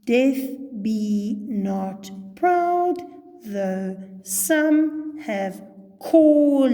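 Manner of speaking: slow verse reading, "called" drawn out as "call-ed"; old two-syllable pronunciation. Shown as a demonstration. Teacher showing John Donne's time pronunciation, "called" said slowly as "call-ed". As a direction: give "called" two separate beats, "call-ed", reciting very slowly. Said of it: "that's how they would have pronounced it".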